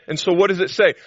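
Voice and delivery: high and loud, hammering the promises; emphatic preaching. Acted. Preacher to the congregation, insisting high and loud. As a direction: drive home every "I will".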